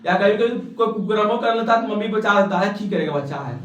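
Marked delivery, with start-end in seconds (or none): 2.7-3.6 lower, softer indulgent mother voice; protective mother. Retold or imitated. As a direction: soothe and excuse the child. Mother shielding child, voiced. (बच्चे को बचाती माँ की नरम आवाज़)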